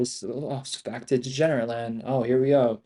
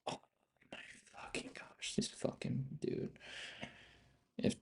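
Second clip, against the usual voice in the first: spaced-out whisper, barely audible; fed-up hushed whisper; exasperated whisper; 20 dB quieter than usual. Real, not acted. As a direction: whisper each word in disbelief.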